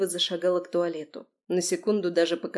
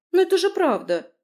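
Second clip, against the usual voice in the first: much higher, louder, hurt protest; offended outburst, high and loud; 11 semitones higher, 7 dB louder than usual. A narrator's reading. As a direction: insist like a wounded friend. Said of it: "добавила обиженно".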